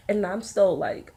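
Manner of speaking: normal talking voice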